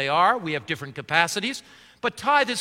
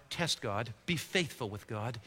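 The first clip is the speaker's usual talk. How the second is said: soft urging; 8 semitones lower, 12 dB quieter than usual. Real, not acted. Pastor urges softly.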